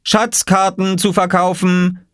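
high, slow vendor's call. A narrator's reading. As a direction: call it out like a street seller. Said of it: "rief Oskar".

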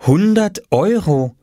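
put-on high and slow child voice; child's call. A narrator's reading. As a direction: sell it like a proud little boy. Oskar names price.

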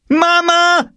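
put-on shrill-high girl's scream. A narrator's reading. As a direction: scream for help. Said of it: "schrie Jule".

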